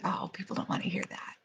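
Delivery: soft, quick, fearful whisper; timid whisper. Retold or imitated. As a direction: hide the thought as if ashamed. Fearful inner thought quoted.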